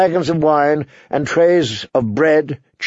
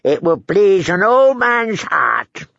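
put-on cackling old lord; 6 semitones higher than usual. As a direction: play a wheezy old host chuckling at his own words.

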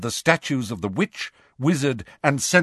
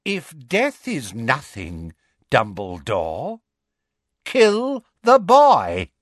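Voldemort voice; slower than usual. Acted. Voldemort speaking through possessed Harry.

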